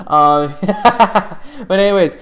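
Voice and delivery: laughing. Real, not acted. Laughing after joke.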